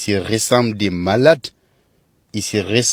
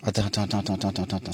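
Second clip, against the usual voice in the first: whispering; 3 semitones lower, 11 dB quieter, much faster than usual. Real, not acted. Shocked reaction, whispered at video.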